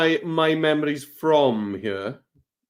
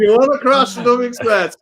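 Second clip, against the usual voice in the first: excited joking; 9 semitones higher, 5 dB louder than usual. Real, not acted. Joking after crash.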